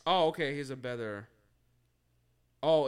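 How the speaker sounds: ordinary delivery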